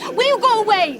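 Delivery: loud, high and fed up; angry plea. Acted. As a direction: drive him off. Sister quarrels with her brother.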